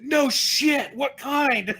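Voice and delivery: a hammed-up stranger calling out; mock excited shout.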